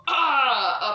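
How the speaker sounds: jump-scare cry at the window; mock scare yell. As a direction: cousins scaring everyone through the window. Cousins scaring at the window.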